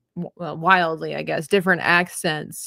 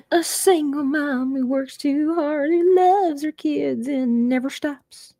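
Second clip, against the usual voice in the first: high, slow Oklahoma twang imitating [name]; [name]'s Oklahoma twang, high and slow; 8 semitones higher, slower than usual. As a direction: drawl it out like [name] singing. [name] moment, quoting song.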